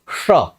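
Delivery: स as a retroflex "sh", tongue curled back; retroflex sibilant. Shown as a demonstration. Teacher showing the cerebral sound, retroflex "sh" on स. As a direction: curl the tongue up to the roof for a hushed स. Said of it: "वो मुड़ करके मूर्धा को touch करेगी".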